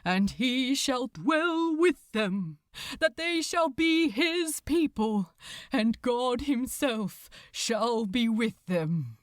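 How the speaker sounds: solemn scripture intoned over the crowd; a priest reciting Revelation, steady and grave; intoning priest. Acted.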